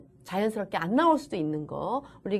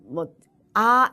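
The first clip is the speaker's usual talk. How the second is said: normal talking voice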